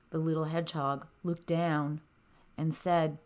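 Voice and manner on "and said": ordinary delivery, nothing marked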